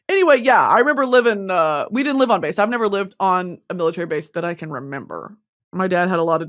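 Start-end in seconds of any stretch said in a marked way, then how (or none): none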